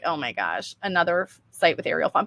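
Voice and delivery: low, quiet mock-bored groan. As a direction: grumble it like a fed-up visitor. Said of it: "it's like".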